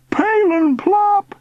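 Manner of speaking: very high, loud, drawn-out call; high shout. A narrator's reading. Uncle carried upward, shouting. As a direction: shout it down from the air. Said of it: "he shouted".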